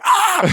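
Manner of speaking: sudden high shriek of fright; startled scream. Retold or imitated. Friend acting the startled family, sudden scream. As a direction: shriek like a hiker spotting a crouched stranger.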